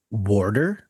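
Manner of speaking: "warder" said as water with an added R. Retold or imitated. Friend asking about grandma's pronunciation, added R. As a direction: say "warder" slowly, R before the middle consonant. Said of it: "pronounce it warder".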